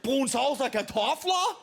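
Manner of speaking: high, laboured mock Danish; exhausting Danish. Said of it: "This other language is exhausting".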